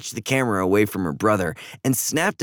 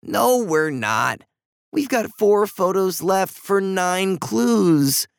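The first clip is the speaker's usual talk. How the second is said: insistent contradiction; 7 semitones higher, slower than usual. Acted. Brother contradicts his crying sister.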